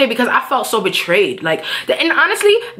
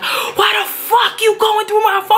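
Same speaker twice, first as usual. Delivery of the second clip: high-pitched mock furious shout; flipping out; 11 semitones higher than usual. Boyfriend caught out over his phone, flipping out. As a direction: act out him blowing up, pitch way up. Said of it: "he flips the fuck out".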